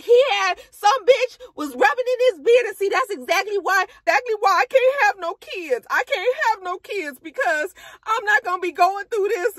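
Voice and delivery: very high, heated venting; upset rant. Retold or imitated. Sierra venting to castmates about BK, high heated. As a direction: vent to the girls, fed up.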